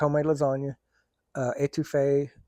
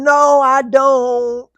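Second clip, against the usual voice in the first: slow, loud, high refusal; hammed-up slow loud refusal; drawn-out no; 9 semitones higher, 10 dB louder, much slower than usual. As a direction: stretch out a loud disgusted no. Refusing to eat it.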